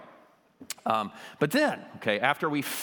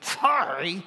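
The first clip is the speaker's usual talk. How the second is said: a higher, louder, scornful sorry; sorry with attitude; 7 semitones higher, 4 dB louder than usual. Retold or imitated. Kids forced to apologize, sulky disdain. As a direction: toss off a sullen sorry. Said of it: "say sorry with so much attitude".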